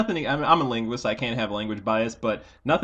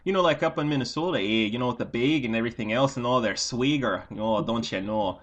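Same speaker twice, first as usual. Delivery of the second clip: put-on playful Minnesota accent with don't you know; mock Minnesota accent. Teasing friends in a playful Minnesota accent. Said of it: "switch in and out of accents".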